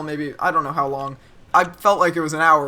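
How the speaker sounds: usual speaking voice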